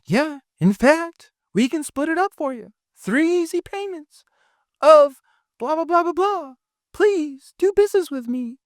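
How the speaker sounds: squeaky, overeager mock sales pitch; mock desperate closer. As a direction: parody the needy closer pleading for the sale. Mocking a desperate closer offering payment plans.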